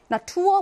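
나 투어 with the stress on 투어; light 나, then accent 투어; second-syllable stress. Shown as a demonstration. Teacher introducing an exam word, 나 투어 stressed on 투어. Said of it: "뒤에 이 강세인".

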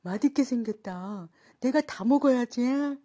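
play-acted food lover eyeing a meal; acted hungry onlooker. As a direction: relish the food you are claiming. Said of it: "이때 선생님의 말과 행동".

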